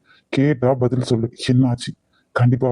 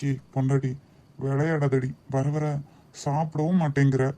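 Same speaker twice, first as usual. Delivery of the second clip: tender teasing; 8 dB quieter than usual. Acted. Husband teasing wife tenderly.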